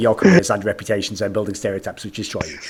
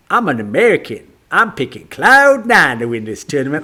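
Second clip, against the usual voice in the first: mock American accent. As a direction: imitate him putting on an American accent. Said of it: "suddenly all tinge of Frenchness goes out of his accent".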